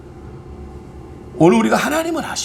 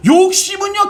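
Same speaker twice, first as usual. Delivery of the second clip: 욕심은요 said with a raised, forceful pitch; emphatic repeat; 6 semitones higher than usual. Acted. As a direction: press 욕심은요 like a preacher driving the point. Repeated lead-in, now emphatic.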